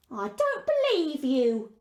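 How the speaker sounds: a land pirate demanding loot; a hint of a rough pirate girl. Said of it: "said the girl".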